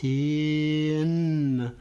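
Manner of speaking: drawn-out slow word; stretched slowly. Shown as a demonstration. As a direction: say it slow like a turtle. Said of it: "say tin very slowly like a turtle".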